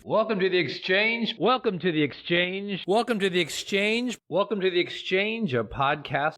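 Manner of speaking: high, bright-sold announcer intro, hammed up; podcast host voice. Shown as a demonstration. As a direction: announce the show like a radio host, over and over. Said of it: "like, radio".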